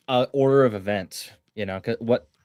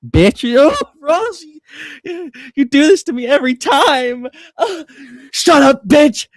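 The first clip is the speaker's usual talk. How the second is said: much higher and louder, laughing into the yell; shrill mock shriek; 13 semitones higher, 7 dB louder than usual. [name] shrieking at Ross, laughing through it. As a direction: screech it back in hammed-up outrage. Acted quarrel, shrieking retort.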